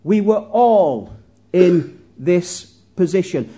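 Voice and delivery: "all" stretched out with slow, deliberate weight; stress on all. Real, not acted. Preacher driving the point home to the congregation, heavy stress on "all". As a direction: lean hard and slowly on "all". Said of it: "I'm emphasizing the word all".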